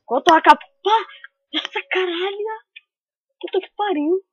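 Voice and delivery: sudden yelling rage at the game; angry outburst. Real, not acted. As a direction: explode in frustration. Gamer raging at game. (xingando furioso)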